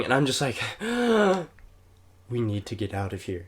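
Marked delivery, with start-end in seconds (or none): 0.6-1.4 high, imitated sobbing gasp; shuddering gasp. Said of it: "that's what I sounded". 2.3-3.5 softer, small voice after a gasp; imitated breathless plea. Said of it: "that's what I sounded".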